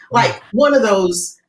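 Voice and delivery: ordinary talk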